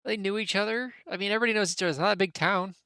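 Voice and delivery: high-pitched townsman getting defensive; Twigs, defensive. Acted. Townsman questioned about two locals' relationship, defensive.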